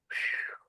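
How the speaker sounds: breathy relieved exhale; soft whispered phew. Retold or imitated. Dreamer waking moved, releasing a soft sigh. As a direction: let out a quiet whispered phew.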